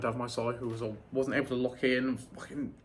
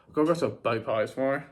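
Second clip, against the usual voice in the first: normal talking voice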